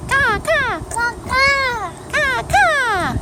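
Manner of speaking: high cawing like a crow. Acted. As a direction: squawk it out like a little crow. Child answers what bird says.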